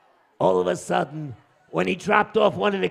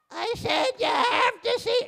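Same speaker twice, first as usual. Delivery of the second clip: very high falsetto repeat; exasperated wife. Acted. Wife repeating herself to her husband, high exasperated voice. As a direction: squawk it out for deaf ears.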